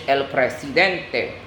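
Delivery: "presidente" in Spanish, preh-see-DEN-teh; Spanish pronunciation. Shown as a demonstration. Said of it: "you call it in Spanish".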